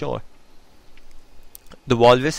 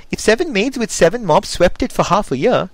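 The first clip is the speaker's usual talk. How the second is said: raised, louder walrus voice; lively walrus voice; 8 semitones higher, 6 dB louder than usual. Acted. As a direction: boom it out as the Walrus. Walrus voiced, loud and high.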